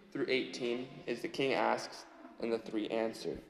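ordinary talk, nothing marked

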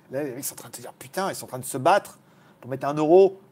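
normal talking voice

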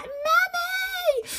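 put-on high, drawn-out child's call for mummy. Little girl stuck on the stair gate calls for mum, high and drawn out. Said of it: "being like".

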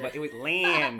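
imitated Midwestern twang. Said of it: "a Midwestern twang in there".

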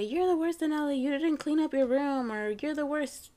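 high, loud example of an insult; hammed-up telling-off; mock scolding. Mother mimics the insult back.

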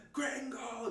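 high-pitched mock yell, not full volume; imitated scream. Rock-throwing attackers shouting at campers, high imitated scream. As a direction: mimic an attacker's high cry. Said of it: "they start screaming".

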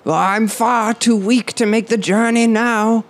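high, feeble-sounding preacher. Acted. Preacher character.

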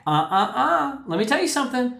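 high, sing-song finger-wagging uh-uh; tut-tut like wagging a finger at someone; scolding no-no. Retold or imitated. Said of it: "I wanna wag my finger and say".

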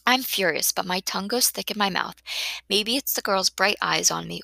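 plain reading voice

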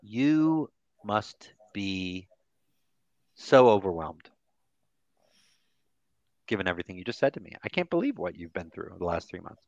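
tender empathetic statement. Shown as a demonstration. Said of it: "these are empathetic statements".

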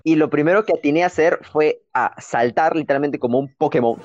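ordinary talk

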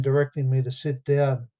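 ordinary delivery, nothing marked

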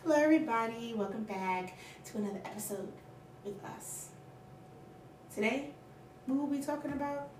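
soft formal newsreader delivery; news anchor voice. Acted. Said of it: "you trying to talk on like a fucking news anchor".

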